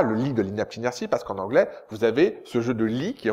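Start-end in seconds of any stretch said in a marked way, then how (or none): none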